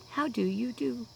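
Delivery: high, prim, put-on how do you do; a lightly hammed-up polite greeting; mock genteel greeting.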